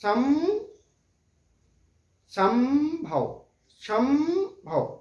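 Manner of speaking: संभव drawn out slowly with a humming म before भव; संभव slowly, lips shut on the म; slow anusvara demo. Shown as a demonstration. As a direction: hold the nasal म in संभव with the lips closed. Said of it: "मुंह देखिए बंद हो जा रहा है".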